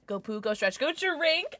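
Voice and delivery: ordinary talk, nothing marked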